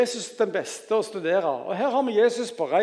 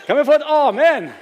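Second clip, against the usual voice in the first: loud, high preacher's shout; rousing call; 7 semitones higher, 12 dB louder than usual. Real, not acted. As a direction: call out to rouse the congregation. Preacher calls congregation for response.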